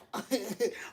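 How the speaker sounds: short soft chuckle; brief amused laugh breaking in. Real, not acted. Laughing at husbands and wives.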